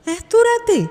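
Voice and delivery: high, startled little question; a young niece gasping at the late hour; surprised. A narrator's reading. Niece asking Pragya in high surprise. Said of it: "Sohini বিস্মিত চোখে তাকালো".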